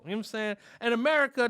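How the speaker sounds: usual speaking voice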